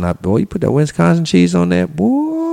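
ecstatic raving. Real, not acted. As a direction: rave about the cheese, voice jumping up. Raving over Wisconsin cheese.

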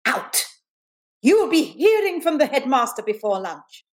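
high, swooping teacher's warning; indignant schoolteacher, acted; stern threat. Teacher threatens pupil in story.

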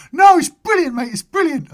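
loud, high, excited shout; high-pitched man's voice. Retold or imitated. Angler reassuring the apologetic raver, high-pitched and delighted. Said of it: "quite a high-pitched voice".

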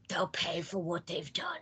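hissed, soft, vengeful whisper; menacing whisper. Retold or imitated. Killer vows revenge after his wife's death, whispered. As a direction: breathe the threat like a movie villain. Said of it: "now he's like".

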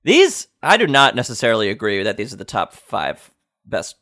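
normal talking voice

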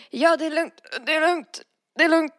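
high, pushed repeated reassurance; a hint of a pale, panicky friend brushing it off; mock nervous fine-fine-fine; insisting he's fine.

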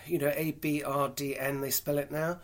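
ordinary talk, nothing marked

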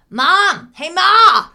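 loud, high call to another room; mock shout calling mom. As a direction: yell for mom down the hall. Eddie calling his mom.

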